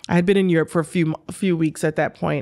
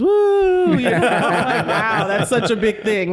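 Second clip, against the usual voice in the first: high, whooping mock cheer; mock celebration; 5 semitones higher, slower than usual. Tennis player teasing herself about going to breakfast, mock celebration. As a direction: play up sarcastic excitement, laughing through it. Mocking own trivial outing.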